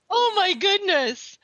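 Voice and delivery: high, slow, wide-eyed gasp of wonder; an exaggerated oh my goodness; hammed-up astonished reaction to a huge binge; mock amazement. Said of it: "I'm just like".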